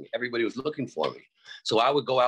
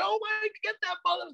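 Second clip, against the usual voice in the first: mock screaming. Girls with afros cheering the battle, mock high scream. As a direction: squeal like an excited girl in the crowd. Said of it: "with afros were screaming".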